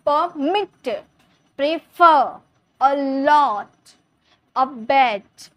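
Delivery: Permit, prefer, allot, abet, each punched on its last syllable; stress on final syllable. Shown as a demonstration. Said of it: "उसमें जोड़ दे रहे थे". (Permit, prefer, allot, abet के आख़िरी हिस्से पर ज़ोर)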